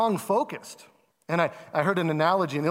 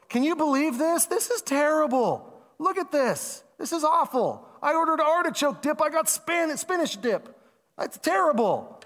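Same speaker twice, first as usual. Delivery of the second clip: much higher, whiny mock complaint; griping diner; 9 semitones higher than usual. Restaurant guest griping to neighbours, high and whiny. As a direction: play the petty diner moaning to the next table. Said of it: "we turn to the people on the table next to us and we go".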